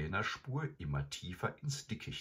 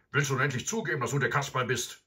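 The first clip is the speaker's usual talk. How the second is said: higher, louder angry snap; snapping; 7 semitones higher, 7 dB louder, faster than usual. A narrator's reading. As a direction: bark at the boy. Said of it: "schnauzte er Seppel an".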